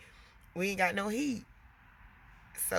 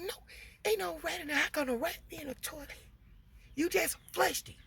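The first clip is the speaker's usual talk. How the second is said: higher, fast, dismissive mom voice; disbelieving; 9 semitones higher, 5 dB quieter, faster than usual. Retold or imitated. Mom telling her daughter there's no rat, high and dismissive. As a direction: brush off the kid's claim. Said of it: "I was like".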